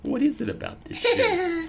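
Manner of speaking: high giggly wonder; giggly cat. Acted. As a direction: puzzle over it through giggles. Cat sniffing shoe.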